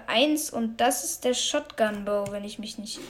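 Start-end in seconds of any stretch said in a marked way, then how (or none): none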